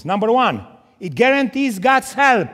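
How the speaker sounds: loud pulpit proclamation, building; preaching at full force. Acted. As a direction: hammer each point to the church. Preacher drives points home.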